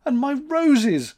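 high, drawn-out wail; weeping. A narrator's reading. June mourns her lost roses, weeping. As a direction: lament slowly, high and loud. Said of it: "wept June".

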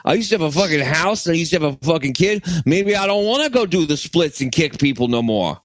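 hammed-up Chicago accent. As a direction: put on a loose Chicago pastiche. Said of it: "Chicago guy".

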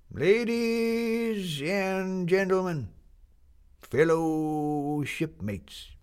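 a doddering captain addressing his shipmates; creaking old sea captain. Acted. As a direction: creak out each word like old ship timbers. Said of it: "in a voice that creaked like timbers".

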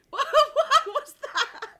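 high, slow, laughing through the words; high-pitched, laughed-out question; helpless laughter. Real, not acted. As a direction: squeak it out while cracking up. Cracks up at own failed accent.

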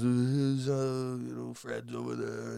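a faint, fumbling take on Biden losing his thread; Joe Biden impression. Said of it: "I can't do a Joe Biden impression".

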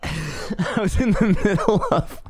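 words spilling out through a laugh. Real, not acted. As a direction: laugh at your own mistake mid-sentence. Laughs while restarting a sentence.

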